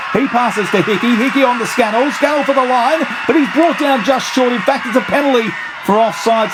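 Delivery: high shouted near-try call; excited shout. Real, not acted. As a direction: yell it as they race for the line. Near-try break, commentator shouting.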